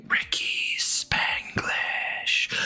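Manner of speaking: hushed, slow whisper; dramatic whisper. Pretend. Streamer whispering a donor's name to chat, slow hushed whisper. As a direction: breathe the name like a secret.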